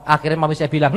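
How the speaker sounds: ordinary talk, nothing marked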